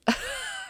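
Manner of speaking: breathy laugh through tears; soft teary laugh. Real, not acted. She laughs at herself while tearing up. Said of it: "I'm getting all teary-eyed again".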